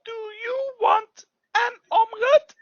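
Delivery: high, loud puppet offering food; excited puppet voice. Pretend. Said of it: "So watch here".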